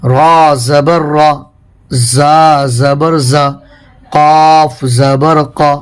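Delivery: each letter spelled out very slowly; slow, deliberate recitation for beginners; slow spelling drill. Shown as a demonstration. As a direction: spell it out like a Qaida teacher. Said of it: "اس طریقے سے اسے مشق میں آپ کو ملا ملا کر پڑھنا".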